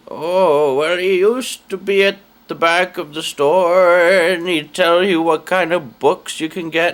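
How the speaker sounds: put-on old lady voice. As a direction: pitch it up like a frail old woman.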